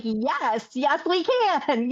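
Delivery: high, slow, delighted yes; light up at her idea; a burst of joyful agreement; excited yes. Retold or imitated. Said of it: "And I'm like".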